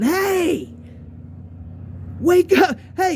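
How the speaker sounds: high-pitched call to wake sleeping friends; yelling out. Retold or imitated. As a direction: holler to rouse friends from afar. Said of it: "I start yelling".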